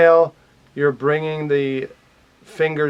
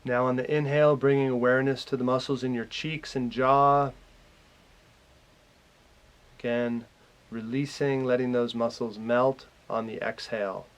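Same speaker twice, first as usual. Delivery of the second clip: calm meditation guide, growing softer and lower; guided meditation; 3 semitones lower, 7 dB quieter than usual. Real, not acted. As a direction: lead them gently through relaxing, with long pauses. Leads a guided relaxation.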